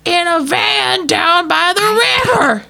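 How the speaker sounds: loud slow motivational-speaker yell; Chris Farley shout. Retold or imitated. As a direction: bellow it like a sweaty speaker. Quoting Chris Farley sketch.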